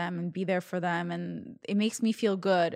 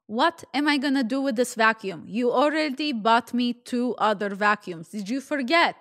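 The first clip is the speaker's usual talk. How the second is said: put-on exasperated Russian-accented mother, climbing higher; mock Russian mom, increasingly indignant; poor Russian accent; 4 semitones higher, 4 dB louder than usual. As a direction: scold in a put-on Russian accent, building to a high complaint. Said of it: "my really poor Russian accent".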